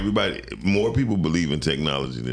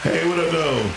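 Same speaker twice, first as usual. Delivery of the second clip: high, loud hyped-up hello; excited greeting; 14 semitones higher, 3 dB louder than usual. Pretend. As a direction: greet them all pumped up. Playful greeting.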